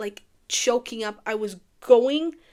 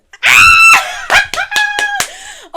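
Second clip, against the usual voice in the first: very high, loud burst of laughter; loud delighted cackle; shrieking laugh; 15 dB louder than usual. Real, not acted. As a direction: scream with laughter. Cracking up at the scene.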